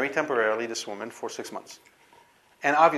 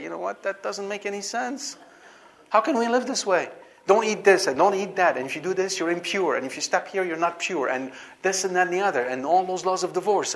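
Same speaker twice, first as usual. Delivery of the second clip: higher, exasperated rant listing the rules; fed-up realization; 6 semitones higher, 3 dB louder than usual. Acted. Someone waking up to the absurd laws, mocking exasperated rant. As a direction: rattle off the rules like you've had enough. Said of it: "wake up and smell the hummus and say".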